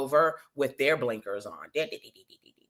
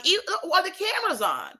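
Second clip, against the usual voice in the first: high-pitched incredulous repeat of a question; squeaked-up, can't-believe-it question; 14 semitones higher, faster than usual. Acted. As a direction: shoot way up high in disbelief. Repeats question, acting out disbelief.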